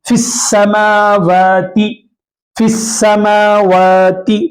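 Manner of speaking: फिस्समावाति very slow, फ flowing straight into a doubled स; slow recitation. Shown as a demonstration. Teacher slowly recites फिस्समावाति after explaining the rule. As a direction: draw out फिस्समावाति, joining फ to स. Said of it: "फा से direct शीन को मिलाकर पढ़ेंगे".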